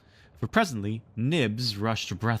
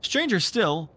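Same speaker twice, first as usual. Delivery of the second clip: ordinary delivery, nothing marked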